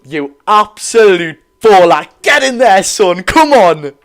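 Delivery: loud, thrilled fan cheer for his player; real joy calling out praise; excited cheering. Real, not acted. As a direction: roar him on like from the stands. Fan cheering returning star player.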